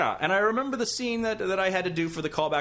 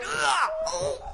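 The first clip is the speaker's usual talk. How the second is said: high strained cries of pain; pained grunts. Retold or imitated. Actor getting an Indian burn, strained pained cries. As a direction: yelp ugh, ugh as your arm is twisted. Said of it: "doing it as hard as she can".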